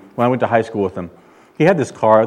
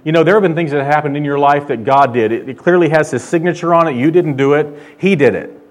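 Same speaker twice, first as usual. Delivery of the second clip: preaching with rising force, louder; 4 semitones higher, 5 dB louder than usual. Acted. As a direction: press the point home from the pulpit. Preaching to congregation.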